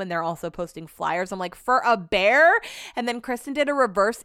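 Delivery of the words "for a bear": slow, loud disbelief rising at the end; retold incredulity, punched on the last word; incredulous question. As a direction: stretch it out as a mock-stunned question. Retells disbelief at flyers for bear.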